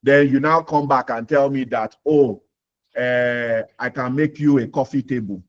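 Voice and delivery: usual speaking voice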